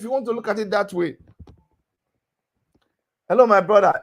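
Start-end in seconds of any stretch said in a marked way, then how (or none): 3.3-3.9 a louder call to grab the floor. Real, not acted. Host to the interrupting caller, louder call for attention. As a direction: cut in to stop the interruption.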